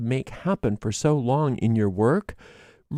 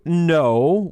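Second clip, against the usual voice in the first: firm loud no; 5 semitones higher, 5 dB louder than usual. Pretend. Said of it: "and you go".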